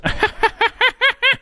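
high, rapid staccato cackle; Kamala Harris cackle. Acted. Podcaster mocking Kamala Harris after a listener's comment, exaggerated cackle. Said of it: "Kamala cackle".

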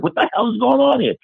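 shocked disbelief. Retold or imitated. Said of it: "I was like".

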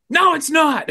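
high, outraged denial; mock yell. Speaker refusing the doctor's answer, outraged. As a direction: refuse to believe it.

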